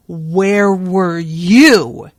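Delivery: slow, loud, drawn-out snarl. A narrator's reading. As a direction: snarl it right in his face, slow and heavy. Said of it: "he snarled into Mal's face".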